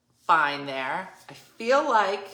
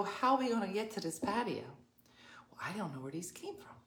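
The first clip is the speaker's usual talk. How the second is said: whispered quoted exchange; 9 dB quieter, faster than usual. Retold or imitated. Whispered back-and-forth quotes.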